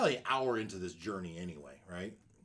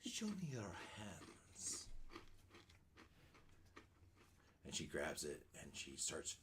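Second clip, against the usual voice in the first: put-on old hag; 4 semitones lower, 14 dB quieter than usual. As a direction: hiss flattery like a crone. Hag flatters dwarf.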